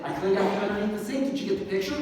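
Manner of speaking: retold eager bid for praise; eager boast. Husband greets wife with his cleaning news, eager boast.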